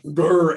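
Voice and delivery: a loud, hard G sound; hard G growl. Retold or imitated. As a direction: bark out a hard G. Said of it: "they're always like".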